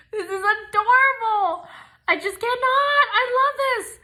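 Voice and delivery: high breaking squeals of love; overjoyed gushing. Real, not acted. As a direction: gush almost in tears. Gushing over bows.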